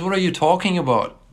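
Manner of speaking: ordinary talk, nothing marked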